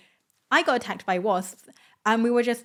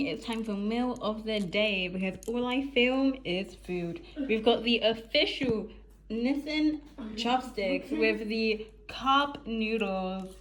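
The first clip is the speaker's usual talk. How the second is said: put-on drawn-out TikTok presenter accent; 4 dB quieter, slower than usual. Vlogger showing cup noodles to the gang, TikTok accent. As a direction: put on a slow TikTok food-review accent. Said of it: "I give myself TikTok accent".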